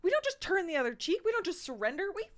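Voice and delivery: loud, high, defiant battle cry; rally everyone to fight, shouting; angry defiance. Acted. Mary refuses to surrender, shouting to everyone defiantly. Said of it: "Mary is pissed. Vocally".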